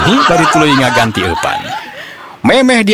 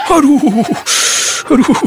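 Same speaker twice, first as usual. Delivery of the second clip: pained yelp. Acted. Line snaps into his mouth.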